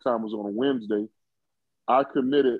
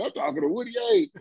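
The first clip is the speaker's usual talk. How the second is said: squeaky star-struck, much higher; lightly fanboy, lifted way up in pitch; giddy excitement. Retold or imitated. Star-struck teen thrilled to talk to Woody Hayes, much higher. Gushing about the call.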